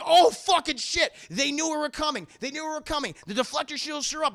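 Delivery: high, loud panicked yell. Acted. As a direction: panic and shout it. Lando panics at the trap.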